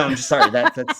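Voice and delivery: usual speaking voice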